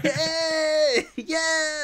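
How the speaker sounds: high excited whoop; party cheer. Pretend. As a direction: cheer like at a barbecue. Cheering Memorial Day party shout.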